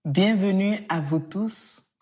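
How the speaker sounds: ordinary talk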